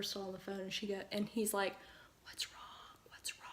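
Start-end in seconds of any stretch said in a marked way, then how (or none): 2.2-3.4 whispered, concerned dad; hushed worried question. Retold or imitated. Dad walks in on his crying daughter, hushed and worried. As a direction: ask it under your breath, worried. Dad finds her freaking out.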